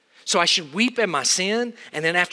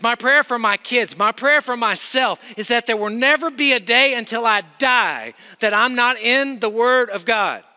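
loud, high, hammering sermon delivery; full-force preaching; 6 semitones higher, 5 dB louder than usual. Acted. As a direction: pound each line home to the students.

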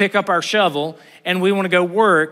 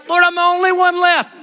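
whiny self-pity; 10 semitones higher, 4 dB louder than usual. Retold or imitated. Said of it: "all of a sudden it's like".

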